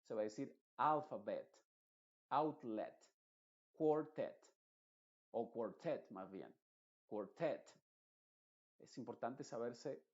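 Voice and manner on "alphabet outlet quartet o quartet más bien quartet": "alphabet", "outlet", "quartet" with the final e as in "bed", each word said slowly and clearly; clear English E. Shown as a demonstration. Teacher drilling the words for students, each one crisp and distinct. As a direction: model each word for the class, ending on a plain E. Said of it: "se va a pronunciar como una E".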